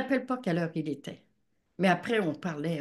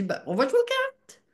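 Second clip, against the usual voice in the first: imitating husband. Husband's voice quoted.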